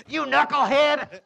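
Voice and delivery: loud, high joking yell; mock thunderous wife. Preacher imitating his wife scolding him as God's mouthpiece, mock thunderous. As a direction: boom it out as a scolding wife, for laughs. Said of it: "through my wife in a thunderous voice".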